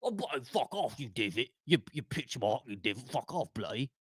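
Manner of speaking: lightly put-on British accent, swearing at the hole; a hammed-up English golfer cursing the hole; playful mock British swearing. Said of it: "walk up to his ball and go".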